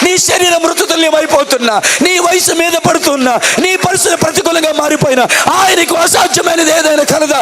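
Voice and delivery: high, shouted revival preaching; fiery preacher crying out to the crowd; preaching at full force. Acted. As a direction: hammer the question, then shout it. Shouted sermon climax.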